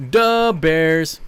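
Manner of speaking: loud Da Bears in a Chicago accent; mock Chicago Bears fan shout. Shown as a demonstration. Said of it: "Da Bears".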